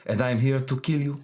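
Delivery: put-on a light French accent, menacing spy; dangerous French woman. Host showing his favorite French villain voice, lightly accented. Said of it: "one of my favorite voices".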